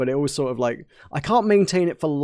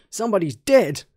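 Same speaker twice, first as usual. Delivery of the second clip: put-on Australian accent; 6 semitones higher than usual. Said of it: "get better at doing Australian".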